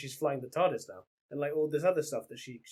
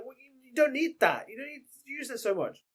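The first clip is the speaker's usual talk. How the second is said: high-pitched, louder put-on voice for her words; mock scolding quote; 10 semitones higher, 4 dB louder than usual. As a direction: squeak it out like a bossy friend telling you off. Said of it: "And she's like".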